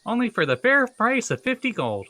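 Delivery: high-pitched kobold sales pitch; kobold vendor. Acted. Kobold hawks knives to a passer-by, high salesman voice. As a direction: pitch your wares in a high voice.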